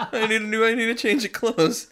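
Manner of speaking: high-pitched mock plea to mom; joking, hammed-up embarrassed child; distressed kid pleading. Plays embarrassed kid phoning mother.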